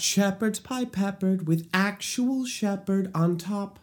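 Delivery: a touch of musical theater flair; quoting a lyric. Shown as a demonstration. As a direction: deliver the lyric like a performer. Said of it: "comes from a little priest in Sweeney Todd".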